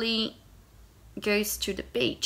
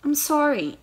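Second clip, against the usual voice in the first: sweetly; 6 semitones higher, 5 dB louder than usual. Acted. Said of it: "I say sweetly".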